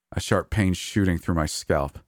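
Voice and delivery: plain reading voice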